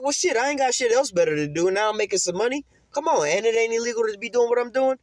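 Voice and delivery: high-pitched mock justifying voice; high, comic self-excusing voice; imitated eager taker. Voices homeless man taking the offer.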